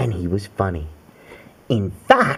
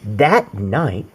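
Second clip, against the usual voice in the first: plain reading voice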